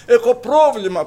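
high, loud shout; full-voiced plea; loud cry. Shown as a demonstration. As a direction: shout it to the Father. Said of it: "με δυνατή φωνή φώναξε".

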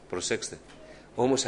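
usual speaking voice

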